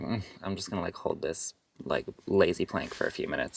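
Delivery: low, soft, unbothered mumbling; can't-be-bothered half-voice; lazy mumble. Retold or imitated. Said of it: "And you're like".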